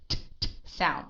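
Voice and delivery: t, t spat out with a hard puff of air; overaspirated t. Shown as a demonstration. Teacher mimicking a student's spitting t. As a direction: spit the t sounds. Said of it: "it sounds like you're spitting at me".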